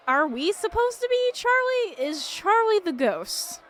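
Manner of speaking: high, slow baffled question; puzzled high voice. Real, not acted. Asking a partner who they play, high bewildered voice. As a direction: squeak out the confusion.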